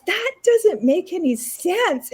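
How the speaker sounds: high, slow, incredulous retort; blunt disbelief. Retold or imitated. Friend staring down the doctor, incredulous retort. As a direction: challenge the doctor flatly. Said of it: "she goes".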